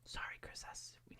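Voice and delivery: hushed apology; breathy whispered sorry. Real, not acted. Whispering sorry to a viewer so the baby sleeps. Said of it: "in our quiet voices".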